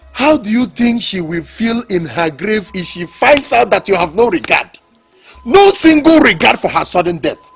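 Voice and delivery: angry rebuke. Acted. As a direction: scold him in rising fury. Sibling rebukes him for disrespect.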